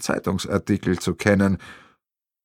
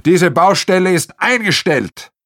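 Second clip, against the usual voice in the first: loud, higher, every word in „Diese Baustelle ist eingestellt!“ sharply clear; 7 semitones higher, 5 dB louder than usual. Acted. Arno halts the building site, „Diese Baustelle ist eingestellt!“ loud and clear. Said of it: "sagt so laut wie deutlich".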